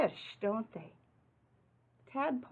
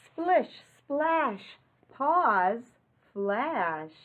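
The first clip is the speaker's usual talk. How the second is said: lively read-aloud rhyme; 4 semitones higher, 5 dB louder, much slower than usual. Acted. Reading rhyming picture book aloud.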